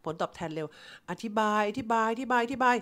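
normal talking voice

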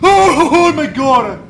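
loud high slow panicked cry; scared yell. Real, not acted. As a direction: cry out in fright. Scared outburst.

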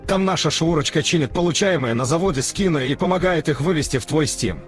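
ordinary delivery

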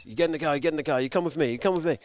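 mildly urgent repeated commands; pushy policeman. Retold or imitated. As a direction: order someone along like an insistent cop. Acted officer ordering him into car.

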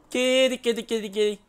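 high, loud nervous cat-calling; coaxing a cat. Pretend. Streamer nervously calling the creature like a cat. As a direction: coax the kitty to come.

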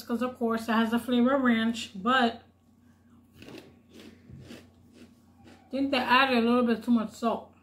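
chewing while talking; crunching snack between words; mouth full of chips. Real, not acted. Seen 0: Puts veggie straws in mouth mid-sentence, jaw working while talking.

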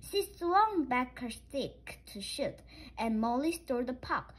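usual speaking voice

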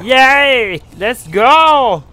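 loud, high cheering; excited cheer. Real, not acted. Gamer cheering at NPC.

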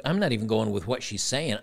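usual speaking voice

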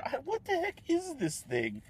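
high, slow baffled cry. Real, not acted. Vlogger puzzling over a green lump in dessert, high and slow. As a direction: stretch out the disbelief at the weird bite. Finding a strange green thing.

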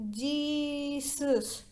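long wailing Jesus that fades out like a candle; Nancy's eerie fading moan of Jesus; drawn-out moan. Shown as a demonstration. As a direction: stretch Jesus into a moan and let it die away. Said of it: "like this".

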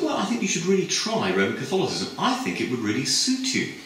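high, brisk and chatty; a lightly voiced girl selling an idea; gushing young woman, pitched high; persuasive student. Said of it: "And she said".

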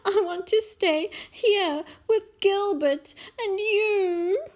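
high, loud, whining tears; sobbing princess. A narrator's reading. Princess begging to stay home with mum, sobbing. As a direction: plead tearfully as a little child. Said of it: "sobbed the little princess".

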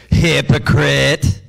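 drawn-out, weighty "Hypocrite"; slow blunt rebuke. Acted. Jesus rebuking the ruler with a slow, drawn-out "Hypocrite". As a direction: land "Hypocrite" slowly and squarely. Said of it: "I love the way he handled him".

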